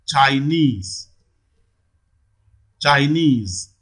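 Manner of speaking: Chinese with a long, falling NESE; stress on last syllable. Shown as a demonstration. Teacher gives an example of the rule, Chinese stressed on NESE. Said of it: "falls on the last syllable".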